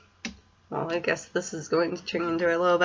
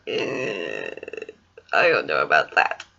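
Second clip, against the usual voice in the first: doubtful; 11 semitones lower than usual. Retold or imitated. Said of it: "So I'm like".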